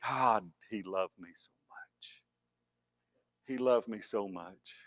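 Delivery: slow, soft and low; hushed wonder at love; tender awe. Real, not acted. Tender reflection on the cross.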